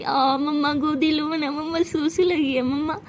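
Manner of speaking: slow, whiny mock toddler; teasing child imitation. Jais runs from the pillow blows mocking Sara, teasing baby voice. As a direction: put on a baby whine to taunt. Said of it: "ऐसे चिढ़ा रही होती है".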